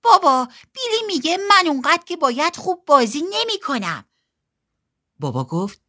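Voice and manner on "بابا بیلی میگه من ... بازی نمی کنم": put-on high, plaintive child's complaint; complaining. A narrator's reading. Betty complains to Dad about Billy, plaintive high voice. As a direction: tattle to a parent in a higher voice. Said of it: "به بابا شکایت کرد".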